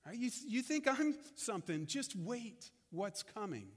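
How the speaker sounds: ordinary delivery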